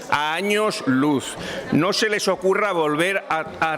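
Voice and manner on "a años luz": slow, drawn-out "a años luz", each word spaced apart; slow emphatic repeat. Real, not acted. MP repeating to opponents that Vox is far from corruption, slow and emphatic. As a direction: stretch "a años luz" out slowly to hammer it home.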